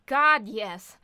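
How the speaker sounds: loud emphatic yes; a forceful God, yes. Acted. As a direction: blurt it out loudly. Eager to keep working.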